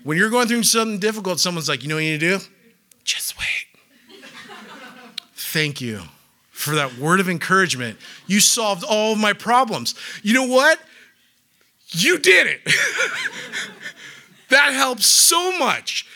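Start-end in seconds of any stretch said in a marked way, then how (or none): 1.8-3.7 mock advice whisper. 5.4-16.0 sarcastic thanks. Retold or imitated.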